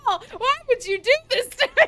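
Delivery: high, loud, fast protest through laughter; laughing wail. Real, not acted. Streamer blaming Paul for the embarrassment, laughing wail. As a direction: wail it mid laughing fit.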